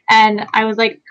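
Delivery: usual speaking voice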